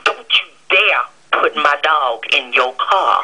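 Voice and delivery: low, slow warning. Real, not acted. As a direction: drop down and lay down the law. Forbids taking the dog.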